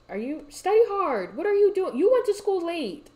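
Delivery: loud, high-pitched telling-off; exasperated scolding. Real, not acted. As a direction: shout in disbelief at a slacking student. Scolding a game character.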